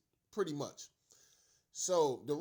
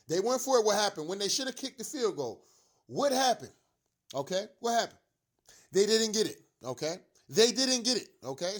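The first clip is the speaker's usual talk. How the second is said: heated rhetorical yelling; 5 semitones higher, 7 dB louder than usual. Real, not acted. Fired-up repeated rhetorical questions.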